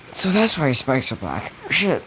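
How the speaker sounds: rising to a high yelp on the last word; pained realisation. Retold or imitated. Hunter rammed into the wall by Nergigante, yelping high in dismay. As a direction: realise too late, then yelp.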